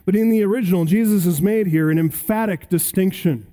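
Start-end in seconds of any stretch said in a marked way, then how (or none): none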